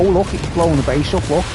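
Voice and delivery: ordinary talk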